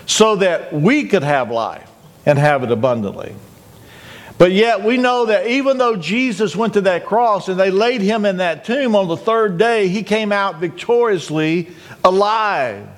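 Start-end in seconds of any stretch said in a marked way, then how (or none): none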